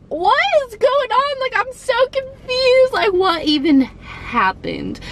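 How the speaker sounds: high, incredulous disbelief; bewildered. Retold or imitated. Retelling the landlady scolding, high confused disbelief. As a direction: squeak it out in confusion.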